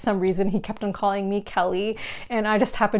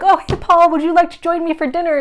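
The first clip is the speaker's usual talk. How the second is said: higher, louder bright greeting; cheery invitation; 8 semitones higher, 6 dB louder than usual. Retold or imitated. Asking the friend on the train to dinner, bubbly. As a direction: brightly invite him over.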